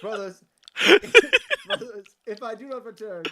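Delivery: dramatic farewell cracking up with laughter; hammed-up farewell, laughing; laughing through it. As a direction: try to stay solemn but laugh. Cleric's dramatic farewell speech.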